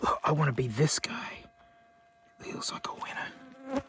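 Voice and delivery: hushed whisper aside; whispered wish to the camera. Real, not acted. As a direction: breathe it under the voice.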